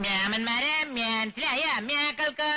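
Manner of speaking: slow Namboothiri Brahmin Malayalam; drawn-out Namboothiri speech, slow; Namboothiri dialect. Shown as a demonstration. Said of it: "നമ്പൂരി ഭാഷ ചോദിക്കണം".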